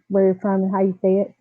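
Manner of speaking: ordinary delivery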